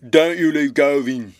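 very loud, rough-crowd bellow; big navvy's cry. A narrator's reading. Navvy with spade warns the captors, loud cry. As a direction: shout the order in a labourer's voice. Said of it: "cried the big navvy".

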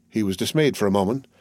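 plain reading voice

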